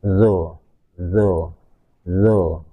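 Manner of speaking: demonstrating Arabic ẓā; emphatic zo. Shown as a demonstration. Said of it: "naik ke langit-langit atas".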